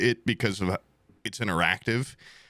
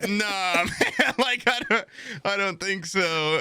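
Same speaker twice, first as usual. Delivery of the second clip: heckler voice; 10 semitones higher, slower than usual. Retold or imitated. Hecklers' reply in sketch.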